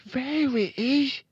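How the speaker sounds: put-on high, loud, drawn-out little-boy voice; high-pitched boy character; young Fred. A narrator's reading. Fred, proud boy in story.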